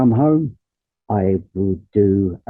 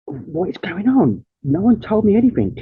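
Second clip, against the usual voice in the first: a retold what-is-happening outburst, pitched up; rising disbelief; 9 semitones higher than usual. Hearing from the nurse he needs blood, rising disbelief. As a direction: voice climbing much higher in stunned disbelief.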